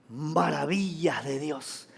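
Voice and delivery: low, slow, very soft answer; hushed awe. Real, not acted. Preacher answers his own question for the congregation, hushed awe. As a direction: let it out slowly and softly.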